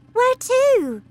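put-on girl crying out to her father; alarmed cry. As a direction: cry out in shock. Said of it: "Matilda cried".

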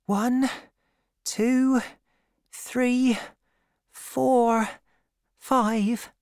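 put-on high-pitched girl's voice, one word at a time; high, separated counting; young woman counting. A narrator's reading. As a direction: count slowly to yourself in a high girlish voice.

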